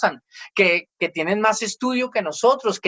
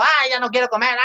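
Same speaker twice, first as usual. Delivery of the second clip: acted tantrum, pitched up; hammed-up huffy kid, higher voice; mock angry child; 5 semitones higher than usual. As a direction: whine crossly like a sulking child. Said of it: "el hijo sale bravo".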